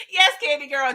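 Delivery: ordinary talk, nothing marked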